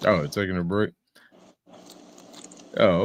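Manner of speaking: usual speaking voice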